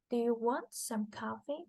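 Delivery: "Do you want some coffee?" climbing in pitch; rising question tune on "Do you want some coffee?"; rising intonation. Shown as a demonstration. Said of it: "has a long rising intonation pattern".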